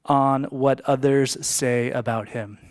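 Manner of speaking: slow, deliberate restatement; slowed repeat. Shown as a demonstration. Preacher repeating a key line slowly to the church. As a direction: spell it out slowly for the congregation. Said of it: "I'm gonna say that again".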